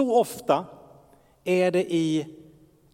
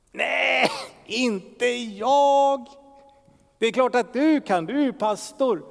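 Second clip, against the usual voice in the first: a higher, louder objecting listener; doubtful churchgoer; 6 semitones higher, 7 dB louder than usual. Pretend. Pastor voicing a congregant who doubts he hears God, objecting.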